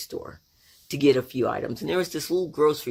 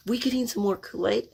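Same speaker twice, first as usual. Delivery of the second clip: put-on high, sweet child's question; little sister; 8 semitones higher than usual. Little Gail smiles up at the store man, high innocent voice. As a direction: ask innocently in a small girl's high voice. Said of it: "sweet little Gail".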